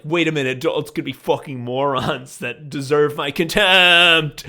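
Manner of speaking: loud, hammed-up epiphany; mock-indignant, well above talking volume; outraged revelation. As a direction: build to a big contemptuous finish. Acts out childhood revelation.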